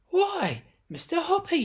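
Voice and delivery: high, loud, happily surprised; delighted cry. Acted. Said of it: "she cried".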